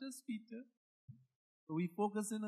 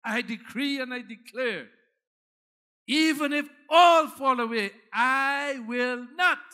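much louder boastful shout, slowing on "I will not"; bold declaration; 6 semitones higher, 14 dB louder than usual. Acted. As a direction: proclaim it like a proud boaster. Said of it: "Peter declared".

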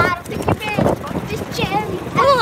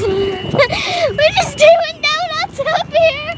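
laughing; 8 semitones higher, much slower than usual. Real, not acted. Laughing while spinning.

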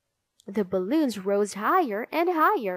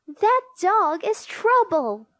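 high, loud complaint about the dog; indignant cry; 9 semitones higher, 6 dB louder than usual. A narrator's reading. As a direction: cry out in alarm, pitched way up. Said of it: "she cried".

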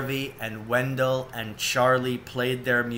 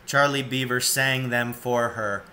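ordinary delivery, nothing marked

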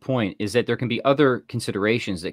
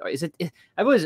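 usual speaking voice

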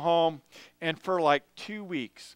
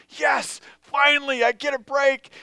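much higher and louder, a gasping shout of relief; relieved cheer; 8 semitones higher, 5 dB louder than usual. Retold or imitated. Worn-out runners reaching the resting group, shouting with relief. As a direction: cheer like rest has finally come.

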